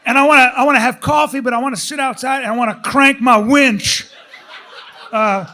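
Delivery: ordinary delivery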